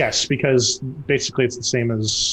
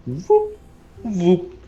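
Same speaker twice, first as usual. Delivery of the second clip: dodging whoop; 5 semitones higher than usual. Acted. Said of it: "So you're just like".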